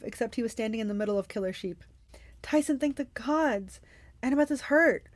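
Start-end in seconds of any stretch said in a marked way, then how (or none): 2.4-5.1 high, relieved call; relieved urgency. Acted. Percy calling to Tyson, relieved urgency.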